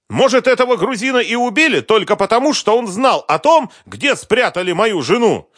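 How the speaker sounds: high, loud indignant yelling; outraged shouting. Acted. Husband who hasn't slept for weeks, furious shout. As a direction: scream out weeks of despair as anger. Enraged husband berating investigator.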